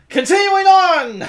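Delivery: high, loud rallying shout; calling out. Acted. Adventurer moving the party along, shouted high and loud. Player calls out in character.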